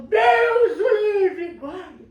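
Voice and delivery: loud, slow, high disgusted yell with a laugh; disgusted shout. Acted. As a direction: recoil from the stench, shouting. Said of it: "o ladrão falou".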